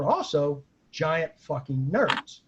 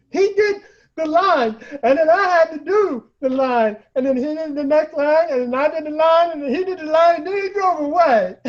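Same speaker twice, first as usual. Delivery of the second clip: high, louder, overwhelmed tearful gush; near tears; 9 semitones higher, 7 dB louder than usual. Retold or imitated. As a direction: blurt it out much higher and louder, on the edge of tears. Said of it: "tears in his eyes, like crying".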